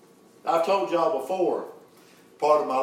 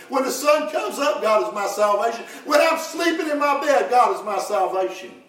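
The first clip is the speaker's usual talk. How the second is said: raised, ringing preacher's chant; preaching at full force; 5 semitones higher than usual. Acted. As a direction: build the refrain higher each time. Preaching rising refrain.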